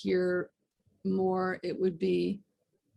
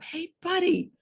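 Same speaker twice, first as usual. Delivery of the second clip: pleading yell; 3 semitones higher than usual. Retold or imitated. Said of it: "the guy yells up".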